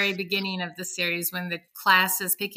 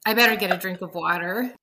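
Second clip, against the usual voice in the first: usual speaking voice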